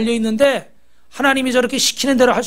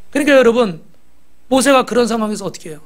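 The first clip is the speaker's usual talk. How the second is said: usual speaking voice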